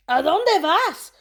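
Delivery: high, loud, drawn-out Spanish question; crying out. A narrator's reading. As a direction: call out after passing friends. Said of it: "cried out Scipito".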